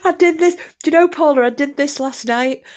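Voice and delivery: high, squeaky through leftover laughter; helpless laughter. Real, not acted. Telling Paula about last night, helpless with laughter. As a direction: struggle to talk after a laughing fit.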